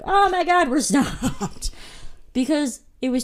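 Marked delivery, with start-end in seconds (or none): 0.0-1.7 high-pitched alarm breaking into laughter; re-enacted sudden-stop panic; mock panic. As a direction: exclaim like a startled driver, laughing. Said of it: "I have to be like".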